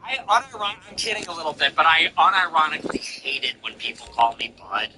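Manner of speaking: ordinary talk, nothing marked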